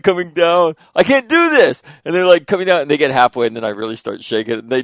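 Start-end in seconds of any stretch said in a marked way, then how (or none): 1.0-1.8 high, loud panicked cry; mock the frightened son; scared kid. Son climbing down ladder, high frightened cry.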